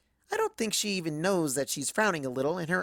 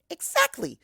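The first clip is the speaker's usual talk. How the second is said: triumphant exactly; 11 semitones higher, slower than usual. Acted. Pinkie triumphant.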